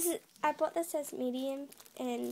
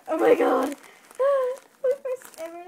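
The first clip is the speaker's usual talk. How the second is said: high, loud thrilled squeal with a laugh; excited squeal; 12 semitones higher, 13 dB louder than usual. Real, not acted. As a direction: shriek with delight at the first squishy. Opening her first squishy.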